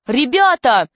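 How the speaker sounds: lightly acted boyish outcry; exclaiming. A narrator's reading. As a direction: announce it to the whole gang with mild excitement. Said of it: "воскликнул Борис".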